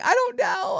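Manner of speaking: high-pitched, exasperated reply; retold exasperation. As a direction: answer at your wits' end, pitched high. Exasperated answer to daughter.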